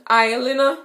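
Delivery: "Aisle" said like aisle, /aɪl/ for oil; Jamaican aisle. Shown as a demonstration. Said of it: "we say aisle".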